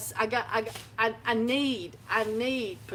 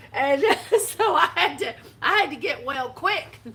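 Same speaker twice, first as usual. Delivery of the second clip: louder, laughing as she talks; laughing through words; 3 semitones higher, 6 dB louder than usual. Real, not acted. Laughing while telling.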